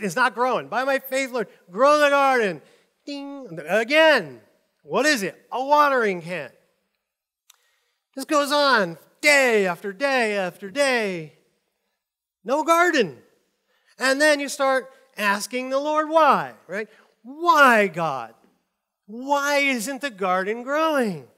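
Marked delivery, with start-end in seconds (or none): 0.7-2.6 hammed-up loud plea to God; mock pleading prayer. 17.2-21.3 loud, slow, plaintive why to God; hammed-up frustrated plea; mock whining prayer.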